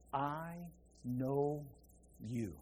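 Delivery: Jesus tenderly telling Mary he knows her; tender, intimate. Shown as a demonstration. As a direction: breathe it like a loving recognition. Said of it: "It's what it says".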